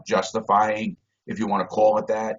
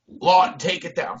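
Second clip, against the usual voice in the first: guard's call; 7 semitones higher than usual. Retold or imitated. Guard demanding he uncover the cell window, high shout. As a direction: yell the name, then drop the order.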